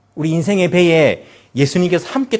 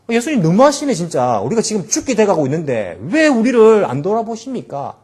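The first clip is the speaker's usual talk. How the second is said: higher, louder, aggrieved whining at Jesus; resentful complaint; 6 semitones higher, 4 dB louder than usual. Retold or imitated. Disciples accusing Jesus in the storm, resentful and loud. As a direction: complain bitterly that he is not helping. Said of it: "원망과 불평 섞인 그런 감정".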